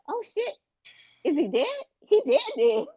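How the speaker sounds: high-pitched mock shock; retold shock. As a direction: act out a stunned discovery, pitched high. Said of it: "she's in shock".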